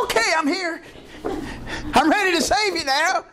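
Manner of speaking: high, chirpy servant voice; eager bellhop. Retold or imitated. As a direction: answer the bell like an eager bellhop. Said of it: "a bellhop just waiting".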